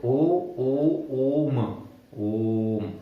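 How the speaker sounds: ओ held long like three O sounds; drawn-out O. Shown as a demonstration. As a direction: stretch ओम out, held for three beats. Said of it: "उतना time लेना है जितना तीन ओ को बोलने में लगेगा".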